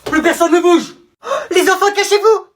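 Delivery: loud, high-pitched urgent warning; acted panic, voice pushed up high; panicked alarm. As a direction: shout orders to frightened children. Intruder alarm in the classroom.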